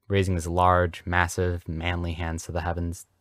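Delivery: ordinary delivery, nothing marked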